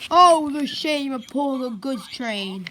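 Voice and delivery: loud mock-tragic lament; melodramatic. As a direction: bemoan it like a tragedy. Gordon laments again.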